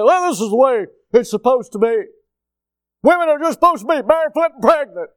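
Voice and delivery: loud, high mock pronouncement; mocking bigoted claim. Mocks people's view of women.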